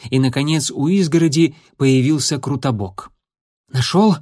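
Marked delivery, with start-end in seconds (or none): 3.7-4.2 puffing, a little louder and higher; panting after running; out of breath. Acted. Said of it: "пропыхтел он".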